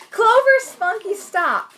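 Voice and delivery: loud, high-pitched yell at the dogs; scolding shout. Real, not acted. Owner interrupting her podcast to stop two dogs, high scolding shout. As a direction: shout sharply to break up misbehaving pets.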